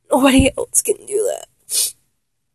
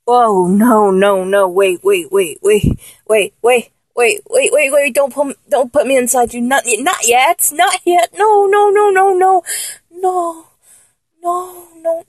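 loud, rapid-fire pleading to stop; urgent protest; 5 dB louder than usual. Acted. Asking partner to wait, urgent and flustered.

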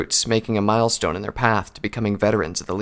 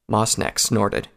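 usual speaking voice

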